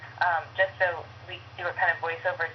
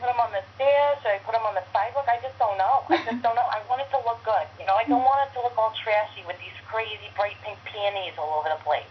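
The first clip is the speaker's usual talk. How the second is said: put-on higher Brooklyn landlady accent; Phyllis; 3 semitones higher than usual. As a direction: fret aloud in a Brooklyn accent. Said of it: "she's, like, so Brooklyn".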